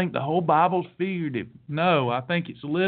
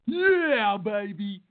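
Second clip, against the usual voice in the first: mock swinging catchphrase; parody cool reassurance; much slower than usual. Parodying an easygoing Jesus.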